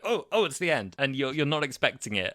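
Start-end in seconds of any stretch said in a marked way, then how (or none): 0.0-1.0 high, loud startled take; jokey shock at the abrupt cut; mock surprise. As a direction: act caught off guard by the sudden ending. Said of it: "You're like".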